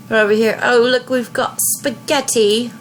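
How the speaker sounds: badly faked British lilt; poor British accent. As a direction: put on an amateur British accent. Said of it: "pardon my poor British accent".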